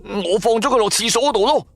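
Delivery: high hurried friend voice; nervous roommate, pitched high; flustered friend. Acted. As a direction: scramble to explain where the cat is. Friend now awake, scared.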